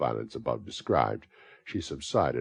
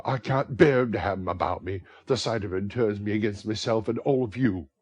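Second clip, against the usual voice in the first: angry Nancy; 6 semitones higher, 7 dB louder than usual. Acted.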